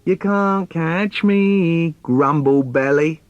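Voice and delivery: put-on little boy dares his dad to chase him; teasing little boy. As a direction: tease like a cheeky toddler. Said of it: "my little boy trying to get me to play".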